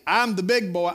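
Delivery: ordinary talk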